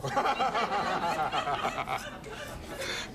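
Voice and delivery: a long breathy giggle at his own pun; laughing at own joke. Real, not acted. TV cook cracking up at his own brown-cow joke, giggling. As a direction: laugh delightedly at the punchline.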